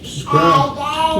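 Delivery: squeaky little voice saying subscribe; high child voice. Pretend. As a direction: pipe it up like a small child. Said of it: "letting you guys know how she feel".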